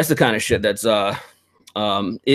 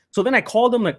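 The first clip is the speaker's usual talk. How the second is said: normal talking voice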